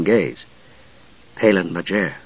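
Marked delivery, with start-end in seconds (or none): 1.4-2.1 softly spoken, lower-pitched introduction. A narrator's reading. Palin telling Usha his name, soft and low. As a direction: give the name gently and low. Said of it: "he said softly".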